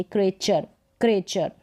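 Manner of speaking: क्रेचर repeated as "cray-char"; T read as CH. Shown as a demonstration. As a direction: model क्रेचर with a "ch", falling each time. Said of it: "च का sound आएगा टी के लिए".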